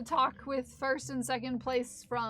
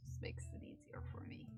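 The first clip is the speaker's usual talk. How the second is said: breathy whispered aside; 14 dB quieter than usual. Real, not acted. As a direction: whisper it to yourself.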